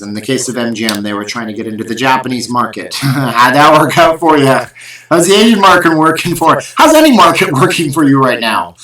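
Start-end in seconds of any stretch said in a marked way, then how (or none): 3.0-8.7 higher and louder, gleeful mockery with laughs; laughing taunt. Real, not acted. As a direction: needle the big company through a laugh. Mocking MGM's failed ventures.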